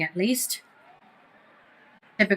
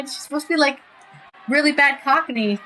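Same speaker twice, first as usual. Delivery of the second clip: usual speaking voice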